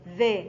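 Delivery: ذا said with the tongue between the teeth. Shown as a demonstration. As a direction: show the tongue out on ذا. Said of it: "من خروج اللسان عند النطق بالذال".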